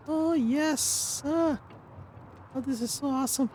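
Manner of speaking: high, gleeful cartoon voice; high squeaky soldier voice. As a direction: squeak like an eager little recruit. Voices an eager unit.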